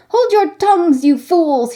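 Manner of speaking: put-on high, loud shrill cry of an angry boy; shrill hunchback boy. Hunchback boy silencing interrupting listeners, shrill and loud. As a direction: snap at the noisy boys, pitched high. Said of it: "he shrilled out".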